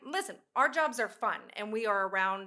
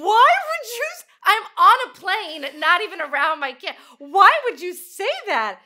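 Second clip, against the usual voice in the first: mock outrage; 10 semitones higher, 14 dB louder than usual. Answering mom's worry about her son, mock outraged cry. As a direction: throw it back at mom, appalled.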